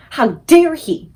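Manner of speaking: slow, loud outrage; indignant cry. Acted. Sophie outraged at the giant, slow and loud. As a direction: spit out the indignation. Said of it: "Sophie cried".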